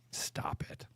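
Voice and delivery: breathy whisper to himself, laughing; laughing whisper telling himself to stop. Real, not acted. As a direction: hush yourself, half laughing. Joking self-rebuke while laughing.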